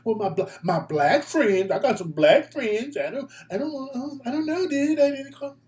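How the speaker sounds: rising to a high, stammering fake-innocent voice; mock flustered caller. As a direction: stumble like someone caught out.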